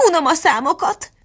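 high-pitched, fed-up Duchess; petulant high exclamation; peevish complaint. A narrator's reading. Duchess bored by numbers, acted.

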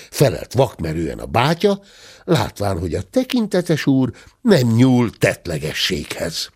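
usual speaking voice

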